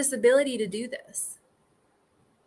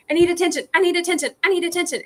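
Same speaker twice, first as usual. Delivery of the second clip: put-on high, louder whiny kid begging; needy little kid; 7 semitones higher, 4 dB louder, much faster than usual. Inner child demanding attention, high and louder. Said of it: "imagine that little kid that's like".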